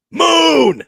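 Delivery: high, drawn-out holler; very loud, high mock shout; mock scream. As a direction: scream the word out suddenly. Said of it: "screamed at her".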